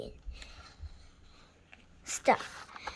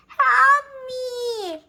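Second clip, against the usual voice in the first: high loud frightened cry; scared scream; 11 semitones higher, 13 dB louder than usual. Acted. Dragon jumps in fright, high loud scream. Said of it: "he screamed".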